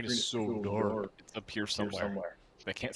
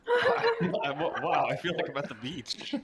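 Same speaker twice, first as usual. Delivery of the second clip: laughing; 5 dB louder than usual. Real, not acted. Laughing at seagull impression.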